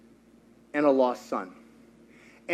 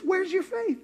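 high, softer pointed rebuke; rebuking Jesus; 12 semitones higher, 6 dB quieter than usual. Retold or imitated. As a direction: ask it as a disappointed teacher.

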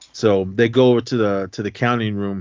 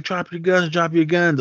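higher, urgent repeated order; half-yelled command, pitched up; mock shouted order; 7 semitones higher than usual. Guards ordering robbers to drop guns, mock shout.

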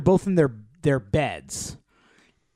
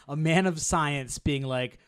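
ordinary talk, nothing marked

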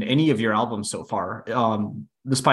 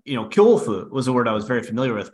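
ordinary talk

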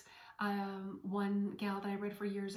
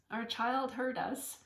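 ordinary talk, nothing marked

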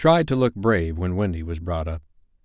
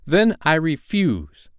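put-on high, slow boy's refusal; defiant cry; 8 semitones higher, 4 dB louder, slower than usual. John standing up to Hook, high defiant cry. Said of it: "he cried".

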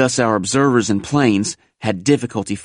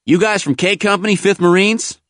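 higher-pitched soldier calling out a question; hailing driver; 11 semitones higher, 3 dB louder than usual. A narrator's reading. Tractor driver to waiting Marines, hailing them. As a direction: call it up to the waiting men.

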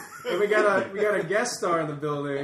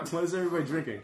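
normal talking voice

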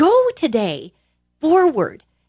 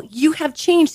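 ordinary talk, nothing marked

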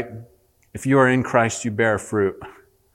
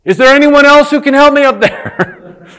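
high, loud, laughing call upward; comic hanging man calling up; laughing punchline; 11 dB louder than usual. Acted. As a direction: shout it up with a laugh in the voice. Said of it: "and he says".

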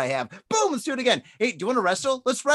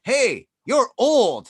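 taunting stranger; 4 semitones higher, 6 dB louder, much slower than usual. Retold or imitated. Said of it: "they'd be like".

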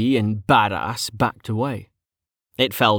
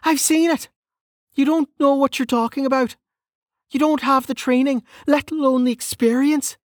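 high-pitched firm rebuke; female officer. Acted. Experienced female officer rebuking the novice, high-voiced. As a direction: set him straight in a high character voice.

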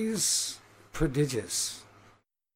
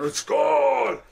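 high, drawn-out roaring toast; wild orc cheer; 13 semitones higher, 5 dB louder than usual. Acted. Orcs echoing the drinker's toast, wild shout. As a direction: shout back like a frenzied crowd. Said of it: "the orcs go shit crazy".

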